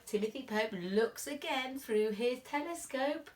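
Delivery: usual speaking voice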